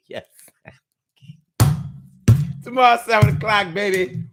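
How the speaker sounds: ordinary talk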